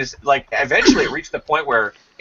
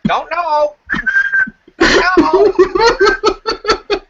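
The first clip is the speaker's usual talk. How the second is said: much higher, loud squawked call; parrot calling; 6 dB louder, much slower than usual. Retold or imitated. Parrot rolling around searching for the baby, high squawked call. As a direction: screech it like a parrot hunting. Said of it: "all you hear from the parrot is".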